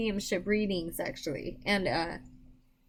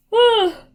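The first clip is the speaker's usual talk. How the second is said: loud high tired sigh; big weary sigh out loud; 11 semitones higher, 13 dB louder than usual. Real, not acted. Exhausted before the reading.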